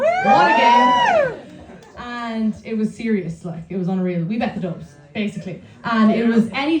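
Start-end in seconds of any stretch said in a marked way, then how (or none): 0.0-1.4 loud, high, drawn-out cheer; excited shout. Real, not acted. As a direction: cheer it out slowly. Crowd cheer, reliving the match.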